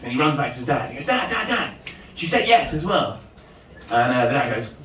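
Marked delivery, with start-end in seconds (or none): 1.0-3.2 high-pitched son with news; lightly acted high boy voice; excited boy. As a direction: call to Dad as an eager kid. Boy reporting back to his dad.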